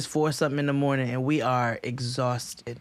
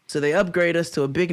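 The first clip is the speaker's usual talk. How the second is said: ordinary talk, nothing marked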